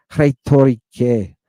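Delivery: slow Rhetorique with a puff before the R; aspirated R. Shown as a demonstration. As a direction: breathe out on the R of Rhetorique. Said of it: "hear the little bit of puff there".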